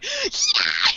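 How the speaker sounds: high mock yell; losing it. Light getting beaten after seeming collected, mock losing it. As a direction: burst out as if losing all composure. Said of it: "He just loses it".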